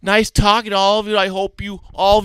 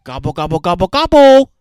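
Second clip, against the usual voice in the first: turkey gobble; 6 dB louder, faster than usual. Pretend. Kids making animal noises.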